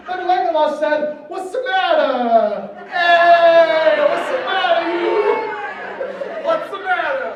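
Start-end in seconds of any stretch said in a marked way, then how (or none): 1.3-7.3 hammed-up mock Italian, drawn out; Italian accent. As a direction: play a cartoonish Italian accent. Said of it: "It's 'cause he's Italian".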